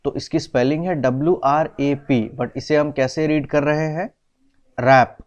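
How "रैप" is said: रैप said louder, beginning on the R; silent W. Shown as a demonstration. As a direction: pronounce रैप firmly without any W. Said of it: "letter डब्ल्यू silent है". (रैप, बिना W की आवाज़, ज़ोर से)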